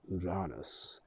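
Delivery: Uranus drawn out slowly as oo-RAH-nus; slow down on Uranus, open RAH in the middle; Uranus, RAH version. Shown as a demonstration. Showing how people say it, Uranus as oo-RAH-nus. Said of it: "'cause people say it how they want".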